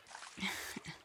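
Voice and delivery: short, quiet breathy laugh; soft chuckle. Acted. Dragon to knight who dropped the sword, soft chuckle. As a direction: chuckle softly under the breath.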